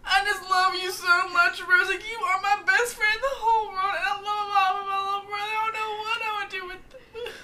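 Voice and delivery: put-on high, loud, teary drunk gushing; drunk friend gushing. Friend mocking drunk Hannah's tearful love speech, high and loud. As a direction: imitate a sobbing drunk friend declaring love. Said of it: "She is like".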